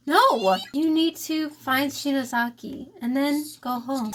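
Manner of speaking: normal talking voice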